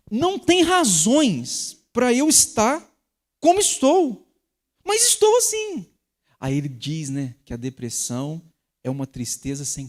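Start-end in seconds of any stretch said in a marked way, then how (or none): 0.1-5.9 mock lament. Voicing a depressed person's lament.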